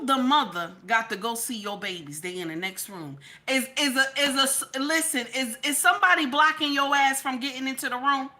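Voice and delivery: usual speaking voice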